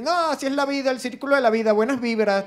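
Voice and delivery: high, sing-song good-vibes voice; hammed-up blissful shrug at a death; mock hippie cheer. An always-happy person brushing off a friend's dead mother, mock blissful.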